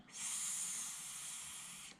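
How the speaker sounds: "S" as a faint, long unvoiced hiss; s sound. Shown as a demonstration. Teacher showing the quiet hissed "S". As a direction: let air hiss out: "S". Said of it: "the sound says s".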